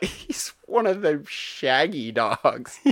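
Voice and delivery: slow, drawn-out parroting in a put-on voice; imitated mocking repeat. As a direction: echo it back slowly, stretching the words. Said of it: "She's like".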